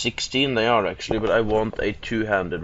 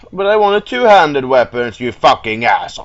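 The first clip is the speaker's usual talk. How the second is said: loud, high, aggrieved wail falling away; indignant outburst; 4 semitones higher, 10 dB louder than usual. Real, not acted. Player denied his two-handed weapon, indignant. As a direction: wail the complaint, then curse. Upset over wrong weapon type.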